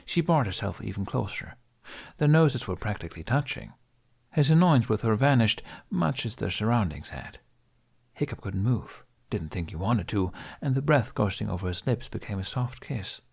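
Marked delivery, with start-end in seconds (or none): none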